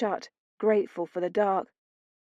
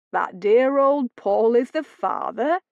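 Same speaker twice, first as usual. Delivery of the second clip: mocking; 3 semitones higher, 5 dB louder than usual. Gideon finishing her sentence mockingly.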